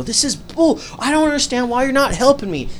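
high-pitched mock complaint; imitated whining protest. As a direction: protest like a customer who keeps arguing. Imitates customer still arguing.

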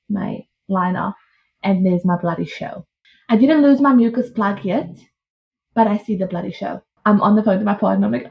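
ordinary delivery

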